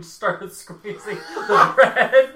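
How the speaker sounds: slow, loud, breaking into laughter; words giving way to a laugh; laughing while talking. Real, not acted. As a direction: crack up as you say it. Laughing at own imagined scenario.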